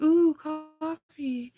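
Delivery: high, slow, enchanted ooh; dreamy ooh. Pretend. Herself tempted by coffee, high slow swooning ooh. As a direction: melt at the thought of coffee. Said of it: "I'll be like".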